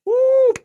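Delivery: very high loud whoop. Real, not acted. Streamer cheering at a troll, loud high whoop. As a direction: whoop in triumph.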